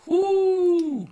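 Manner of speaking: high, loud hype whoo; excited whoop. Real, not acted. Recalling starting the music with his friend, a high, loud excited whoop. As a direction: cheer like the crowd just went wild.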